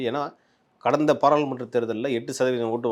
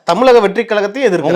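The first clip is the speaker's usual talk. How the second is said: much louder and higher, insisting; raised insistent voice; 9 semitones higher, 11 dB louder than usual. Real, not acted. Interviewer insisting that they oppose this party too, loud raised voice. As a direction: push the point loudly over the guest.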